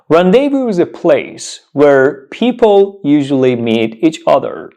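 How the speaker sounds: usual speaking voice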